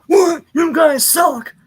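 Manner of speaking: high, loud outburst, drawn out; hammed-up furious shout; throwing a fit. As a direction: blow up at the whole team. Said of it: "he has a fit".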